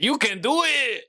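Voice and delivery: high, slow mock cheer; a quick silly Rob Schneider bit; showing the rep what he wants; Rob Schneider impression. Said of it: "your best impression of him going like".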